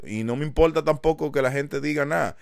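ordinary talk

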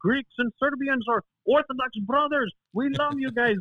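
lightly voiced effusive uncle; imitating hearty uncles. Friend's Serbian uncles welcoming their Greek brother, imitating hearty uncles.

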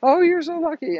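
high, mock-excited neighbor voice; gushing neighbors. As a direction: squeal it like a gossiping neighbor. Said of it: "they go".

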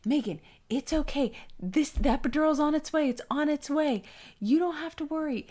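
calm her down kindly; husband reassuring his wife in labor, gently; soothing husband. Retold or imitated. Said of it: "And Robert was so nice".

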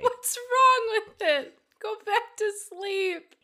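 high, slow coaxing voice; mock cooing voice. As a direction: coo at it like a baby. Mocking the creepy Pokemon art.

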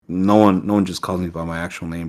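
ordinary talk

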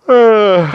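big high, loud storybook yawn; acted yawn. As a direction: yawn out loud as the tired bear. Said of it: "yawned Bear".

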